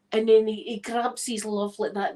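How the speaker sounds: ordinary talk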